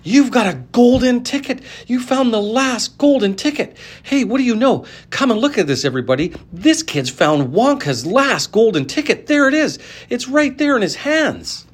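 can't believe it, tell everyone; excited shouting. A narrator's reading. Shopkeeper yelling to everyone.

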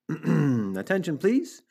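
high formal call for attention, rising on please; piglet's earnest public announcement; loud, firm voice. A narrator's reading. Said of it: "in a loud, firm voice".

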